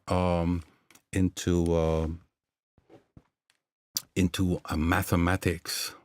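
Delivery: ordinary talk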